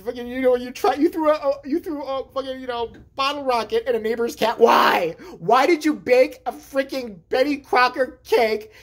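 loud, high, fed-up mother scolding; mother yelling about the neighbor's cat; exasperated mom rant. Retold or imitated. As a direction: rant at the kid who did it again. Mother yelling at misbehaving kid.

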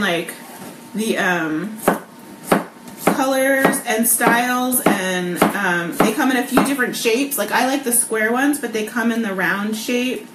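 ordinary talk

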